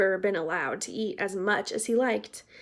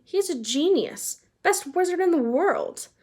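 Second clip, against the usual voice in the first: a higher, lightly voiced airy brag; airily; 8 semitones higher than usual. A narrator's reading. Percy brushing off Harry's worry about Dumbledore, airily. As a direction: toss it off as if it's obvious. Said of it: "said Percy airily".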